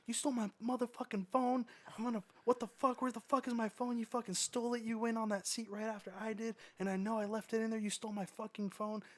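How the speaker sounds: high, shrill-pitched accusing yell; yelling teen girl. Retold or imitated. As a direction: voice the angry thirteen-year-old accusing the dad. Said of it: "yelling at the dad".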